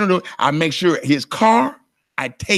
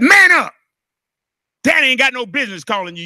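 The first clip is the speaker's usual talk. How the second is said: stern shout; 7 semitones higher, 5 dB louder than usual. Retold or imitated. Scolding his kids.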